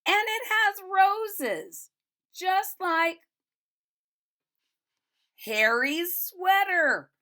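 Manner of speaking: high, loud and slow shout; shouted together. A narrator's reading. Children at the tree shouting about the sweater, high and slow. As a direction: call it out in wonder, drawn out. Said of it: "they shouted together".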